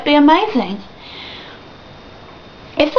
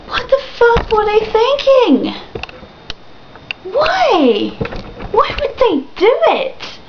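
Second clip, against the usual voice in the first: high, incredulous wail; exasperated disbelief; 8 semitones higher, slower than usual. Real, not acted. Fan demanding why the makers changed Spyro, exasperated. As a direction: plead for an explanation, pitched high.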